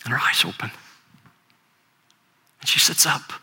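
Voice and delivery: breathy hushed whisper of wonder. A narrator's reading. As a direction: let the words fall to an awed whisper. Hushed climax of a miracle story.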